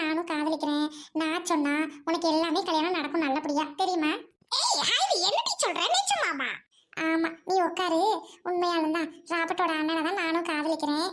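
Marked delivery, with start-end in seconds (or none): 4.6-6.5 high, startled disbelief; surprised. Acted. Friend hearing about her secret love, high and startled. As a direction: react with amazed surprise.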